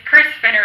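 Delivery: Chris said with a short i, as in "kiss"; correct pronunciation. Shown as a demonstration. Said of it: "is how it's actually pronounced".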